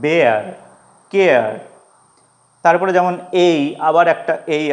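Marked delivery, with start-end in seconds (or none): 0.0-1.5 "বেয়ার, কেয়ার" each gliding from এ to আ; ea diphthong demo. Shown as a demonstration. Teacher repeats bear and care to show the ea glide. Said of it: "এর উচ্চারণটা এ আর মতো হচ্ছে".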